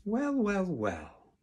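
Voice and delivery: high, drawn-out drawl falling away; taunting drawl. A narrator's reading. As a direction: draw each word out tauntingly. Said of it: "drawled Snotlout".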